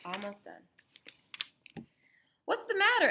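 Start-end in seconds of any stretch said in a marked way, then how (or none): 2.4-3.1 worried mother. Acted. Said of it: "asked Mama".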